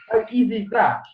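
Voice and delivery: ordinary talk, nothing marked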